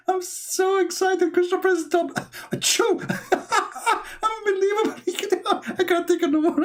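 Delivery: very high giggling squeal; helpless mock laughter in falsetto; pretend Allah overjoyed. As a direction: gasp the words through hammed-up hysterics. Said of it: "This is Allah now".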